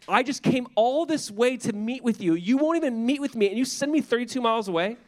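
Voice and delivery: high, indignant complaint from a proud man; offended. Retold or imitated. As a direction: sound insulted at being sent away. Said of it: "He feels so offended".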